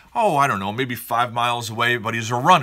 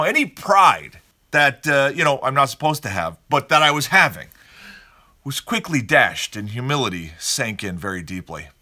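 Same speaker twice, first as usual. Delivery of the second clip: normal talking voice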